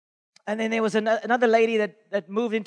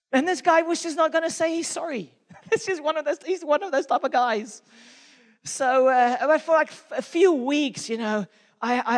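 ordinary talk, nothing marked

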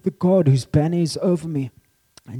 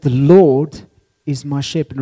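"Lord" stretched out and louder; stress on Lord; 6 dB louder than usual. Shown as a demonstration. Preacher returning to the first phrase, stress on "Lord". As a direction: swell on "Lord", then relax. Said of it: "let's start with that, that first phrase".

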